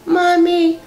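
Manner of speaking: put-on high, thin little child calling out; small child's voice. Unborn baby calling to his mother in a high child's voice. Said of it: "the little voice says".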